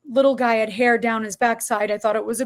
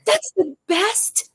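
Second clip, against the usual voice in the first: sarcastic; 7 semitones higher, slower than usual. Real, not acted. Mocking the haircut as the best.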